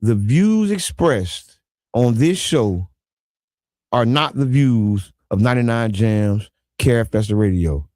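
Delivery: slow, deep, firm disclaimer read. Acted. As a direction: drop low and read it weightily. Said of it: "reads disclaimer in deep voice".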